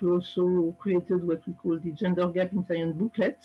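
normal talking voice

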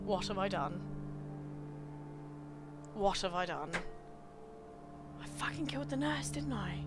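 low, very quiet murmur; hushed, low self-reproach; stunned regret. Real, not acted. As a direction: say it softly to yourself as it sinks in. Realising own fatal mistake.

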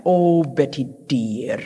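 slow, lilting Scottish endearment; Scottish accent. Retold or imitated. Widow to her widowed friend, Scottish accent. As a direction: imitate a dear Scottish friend, drawing it out. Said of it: "in her wonderful Scottish accent".

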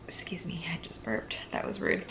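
breathy whispered apology. Real, not acted. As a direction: whisper it, a little embarrassed. Apologising for a burp.

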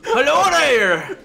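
high-pitched, hammed-up Irish with no lips; a silly Irish veteran who lost his lips; lipless Irish accent. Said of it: "makes me Irish and I have no lips".

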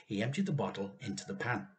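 usual speaking voice

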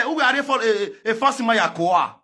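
ordinary talk, nothing marked

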